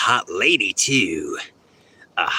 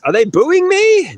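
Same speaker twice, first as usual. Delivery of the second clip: much higher, louder alarmed question; mock alarm; 7 dB louder than usual. Asking if the crowd boos, mock alarmed. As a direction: squeal it like you're hurt.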